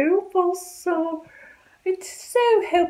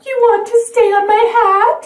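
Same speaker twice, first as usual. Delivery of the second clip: excited; 6 semitones higher, 6 dB louder, faster than usual. Acted. Talking to the bird.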